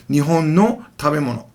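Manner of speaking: "Nihon no tabemono." then a full stop; pause after the phrase. Shown as a demonstration. Teacher pausing after "Nihon no tabemono.".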